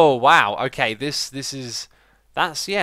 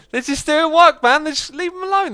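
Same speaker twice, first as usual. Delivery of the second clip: much higher, bright squeal of delight; 13 semitones higher, 6 dB louder than usual. Real, not acted. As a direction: coo over the busy workers. Watching zombies work, delighted.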